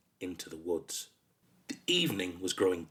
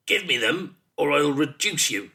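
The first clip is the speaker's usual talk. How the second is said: loud, higher-pitched monster threat; growled threat; 5 semitones higher, 6 dB louder than usual. A narrator's reading. Monster threatening boy for his buttons, growling. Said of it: "The Wordle growled".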